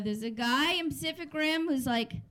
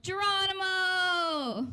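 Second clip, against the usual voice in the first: higher, drawn-out heroic cry; action-hero battle cry; 5 semitones higher, much slower than usual. Acted. Action movie guy in close-up, drawn-out mock battle cry. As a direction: stretch it out like a hero leaping in.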